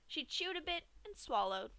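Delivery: plain reading voice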